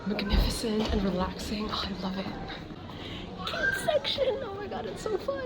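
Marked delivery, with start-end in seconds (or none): 3.5-5.4 much higher, delighted and soft; excited squeal. Real, not acted. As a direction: gush over something cute. Spots the kids section.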